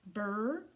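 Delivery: Bur-oo-m split into slow separate sounds; segmented sounding out. Shown as a demonstration. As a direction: stretch each part of Bur-oo-m apart. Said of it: "what says that oo sound".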